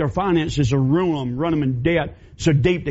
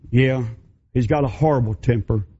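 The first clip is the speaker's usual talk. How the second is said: lower, subdued battered woman; 6 semitones lower than usual. Acted. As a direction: admit it low and ashamed. Mary confessing.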